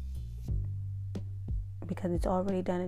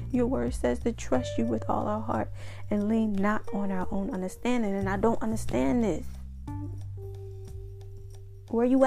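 heartfelt prayer; 4 semitones higher than usual. Pretend. Acted prayer to God.

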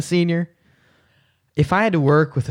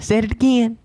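higher-pitched mimicry of a clip; mocking echo; 9 semitones higher, 3 dB louder than usual. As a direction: parrot it back, pitched well up. Mimics the line just played.

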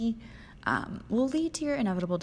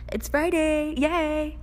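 high, loud, dopey mock cheer; sarcastic impression of an overly chipper texter; mocking a cheesy guy; 8 semitones higher, 7 dB louder, slower than usual. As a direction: ham up the lame guy's text. Mocking the guy's text.

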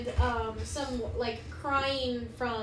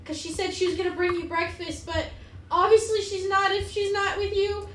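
higher-pitched, lively mayor voice; worried town mayor; 6 semitones higher, 4 dB louder than usual. Acted. Mayor tells the party children are missing, higher worried voice. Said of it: "she comes up and she goes".

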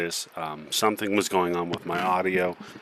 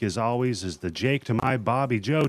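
normal talking voice

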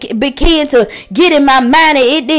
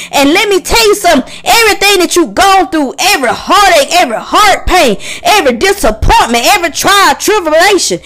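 full-force preaching; 4 semitones higher than usual. Acted. Pastor urging listeners to surrender their pain to God, preaching hard. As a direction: preach it out, lifting the congregation.

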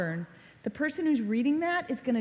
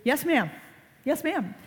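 Charlie Brown's reply; 5 semitones higher than usual. Retold or imitated. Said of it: "what does Charlie Brown always say to her".

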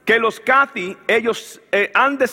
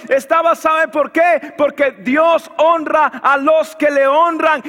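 preaching at full force; 6 semitones higher, slower than usual. Acted. Pastor preaching to his church, building to a shout.

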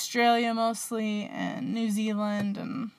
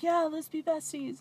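put-on imagined Australian accent, pitched higher; sweet Australian accent; 6 semitones higher than usual. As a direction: play the singer answering in a sweet Australian accent. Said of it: "in her sweet Australian accent".

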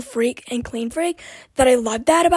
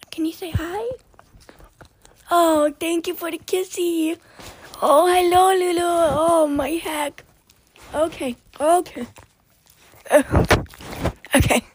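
high cooing pet voice; 5 semitones higher than usual. Real, not acted. Talking to her dog, high cooing baby talk.

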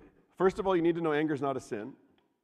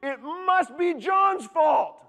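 mock blaming whine; 13 semitones higher, 9 dB louder than usual. Preacher acts out blaming John in anger, high mock whine. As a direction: whine it like a wronged child. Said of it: "in our anger, we go to".

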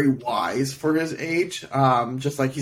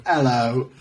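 put-on British accent. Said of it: "We're gonna have, like, a British listener".

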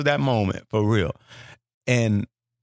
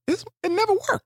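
baffled high disbelief. Retold or imitated. Baffled at the dead radio.

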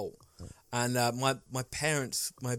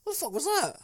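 much higher, outraged imitation of dad; shocked dad; 14 semitones higher than usual. Old-school dad seeing his son kissed, outraged high yell. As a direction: mimic the dad's shocked outburst, pitched way up. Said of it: "And then my dad just goes".

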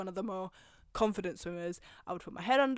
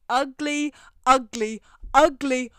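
each ugly louder, hammered out; emphatic ugly; 4 semitones higher, 12 dB louder, slower than usual. Real, not acted. Mocking the swim teacher, loud and building. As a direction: spit out ugly, ugly, ugly with rising force. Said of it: "I can't stress you enough".

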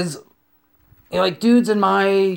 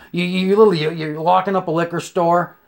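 ordinary talk

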